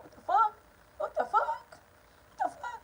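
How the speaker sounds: rising incredulity, building up; stunned disbelief at the brother, pitch soaring. Real, not acted. Incredulous repeated exclamation.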